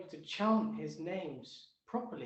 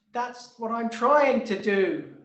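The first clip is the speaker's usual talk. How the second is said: sudden loud declaration; 7 semitones higher, 15 dB louder, slower than usual. Acted. Tree reveals its plan.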